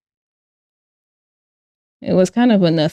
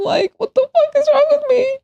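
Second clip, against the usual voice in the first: high squeaky wail; mock wail. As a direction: shriek it in mock despair. Self-mocking wail over bad drawing.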